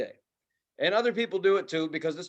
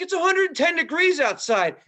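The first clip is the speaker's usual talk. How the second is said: high, loud mock rant about dog mess; ranting phone call; 9 semitones higher, 3 dB louder than usual. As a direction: imitate the owner ranting on the phone. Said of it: "she's like".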